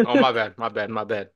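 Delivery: usual speaking voice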